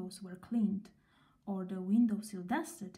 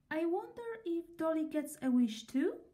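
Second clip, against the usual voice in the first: put-on little girl voice; 7 semitones higher than usual. Little girl wonders aloud.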